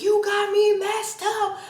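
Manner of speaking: high-pitched mock scream at him. Yelling at the guy who insulted her brother, high mock scream. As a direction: shriek it up high, slowed and stretched. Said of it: "I just start screaming at the top of my lungs".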